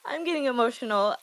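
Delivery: teary laugh through the words; real emotion breaking through nervous laughter; getting emotional. Real, not acted. Wife praising her husband on stage, teary and laughing.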